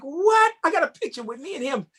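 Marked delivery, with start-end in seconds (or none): 0.0-0.5 much higher, squeaky what of disbelief; stunned what. Retold or imitated. Dancer recalling shock at seeing his hero, high squeak. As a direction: squeak it out, astonished. Amazed Peg Leg Bass came.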